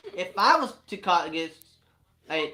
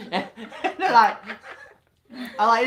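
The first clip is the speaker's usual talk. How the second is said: ordinary talk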